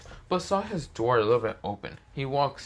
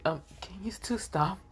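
high, softly voiced plea; small high-pitched voice for a flustered friend; lightly acted embarrassment; embarrassed interjection; 9 semitones higher, 4 dB quieter than usual. Embarrassed friend interrupts the flirting couple.

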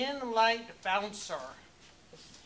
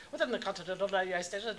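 fast ad disclaimer; 4 semitones lower, faster than usual. Pretend. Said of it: "do the disclaimer".

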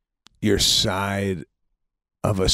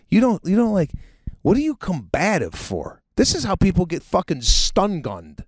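higher, scolding cop yelling at the driver; angry cop; 9 semitones higher than usual. Pretend. Cop scolds the driver at the stop, higher and angry.

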